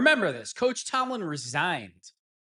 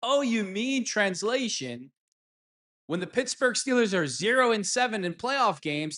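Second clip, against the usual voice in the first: normal talking voice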